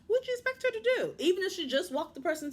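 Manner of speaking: exasperated, voice shooting way up; high-pitched incredulity. Real, not acted. Vlogger challenging the husband who locked her out, incredulous. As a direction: squeal the question in disbelief.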